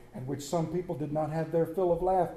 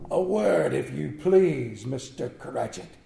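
low, slow mock-gruff old miser; feigned growl; 6 semitones lower, slower than usual. Scrooge faking sternness at late Bob, low and slow. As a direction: put on the gruff voice he used to have. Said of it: "growled Scrooge in an unaccustomed voice".

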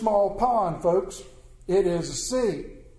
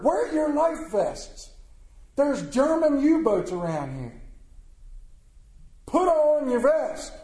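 slow, raised-pitch mock command from the captain; captain's order; 7 semitones higher, slower than usual. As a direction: bark out the captain's order, slowly and high. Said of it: "made it a, an order".

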